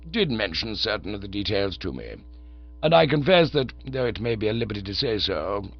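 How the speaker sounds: calm, unruffled manservant; Jeeves the valet. Acted. Jeeves confesses his scheme.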